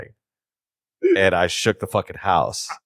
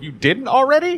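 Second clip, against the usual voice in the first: very high-pitched retold question; mock incredulous; 4 dB louder than usual. Coworker asking about the bathroom trip, mock incredulous. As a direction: ask it high, as if amazed.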